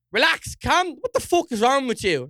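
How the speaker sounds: alarmed shout. Retold or imitated. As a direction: shout to stop the man with the bottle. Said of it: "I'm like".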